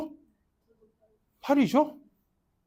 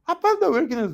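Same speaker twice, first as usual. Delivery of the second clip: mock daughter's outburst; 11 semitones higher, 3 dB louder, much faster than usual. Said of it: "딸이 돼가지고 아빠한테 막".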